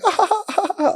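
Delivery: high, soft mock cooing; imitated delight. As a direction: coo helplessly under a pile of puppies. Mimics their reaction to puppies.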